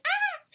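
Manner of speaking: short, high-pitched imitated squeal; imitated baby squeal. Mother showing how baby clings, imitated squeal. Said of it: "he'll hold me tight, like".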